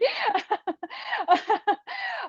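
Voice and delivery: high, long burst of giggles; high giggling fit. Real, not acted. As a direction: giggle helplessly. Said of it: "I'm laughing 'cause".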